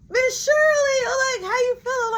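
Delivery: very high, excited squeal of welcome; gushing greeting. Retold or imitated. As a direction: fuss over someone just back. Teller greets returning Shirley.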